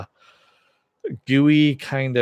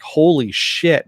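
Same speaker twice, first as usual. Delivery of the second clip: slow, louder mock gasp of shock; shocked outburst; 5 semitones higher, 6 dB louder, much slower than usual. [name] sees the sunburnt legs, slow, louder shocked outburst. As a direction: draw it out, half-hammed horror.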